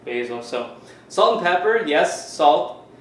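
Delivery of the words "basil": basil said BAY-zil, with a long A; basil the Canadian way. Shown as a demonstration. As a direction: say basil to rhyme with "hazel". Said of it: "I just say".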